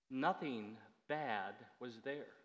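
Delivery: normal talking voice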